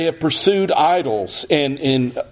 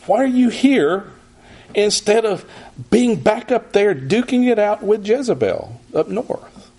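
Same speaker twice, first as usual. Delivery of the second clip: higher-pitched scolding questions; rebuking; 8 semitones higher than usual. Shown as a demonstration. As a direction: press him hard, like a rebuke. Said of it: "if we think this is a rebuke".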